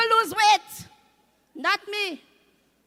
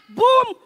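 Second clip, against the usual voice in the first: loud, punchy bomb boom; mock explosion boom; 5 semitones higher, 10 dB louder than usual. Comic mimicking war news, a loud bomb boom. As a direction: burst out a big boom.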